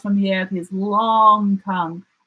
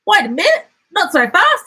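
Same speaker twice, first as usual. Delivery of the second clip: much higher, louder prickly echidna; bristling echidna; 13 semitones higher, 6 dB louder, faster than usual. A narrator's reading. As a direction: bristle and cut them off. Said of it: "he bristled".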